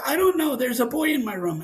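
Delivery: lightly voiced distressed kid; retold upset child. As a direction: imitate an upset son answering his dad. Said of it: "why are you upset".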